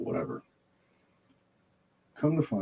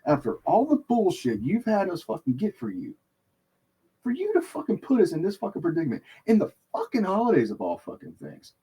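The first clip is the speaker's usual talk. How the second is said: retold screaming rant, pitched way up; heel persona rage; 10 semitones higher, 4 dB louder than usual. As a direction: tear into her like a wrestling heel. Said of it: "straight fucking heel Draven Grimes".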